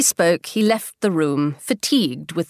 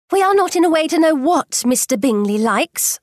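high, resentful mother's voice; 8 semitones higher than usual. A narrator's reading. Mother sulking that the family cannot visit, high and resentful. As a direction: sulk it out in a high complaining pitch. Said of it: "said her mother resentfully".

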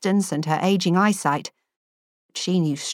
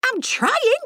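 put-on flustered young woman. A narrator's reading. Young Elaine voiced high.

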